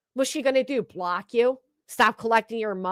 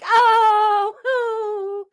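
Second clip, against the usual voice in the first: mock gasp; 10 semitones higher, 3 dB louder than usual. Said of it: "they're all like".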